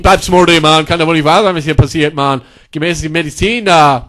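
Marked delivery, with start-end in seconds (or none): none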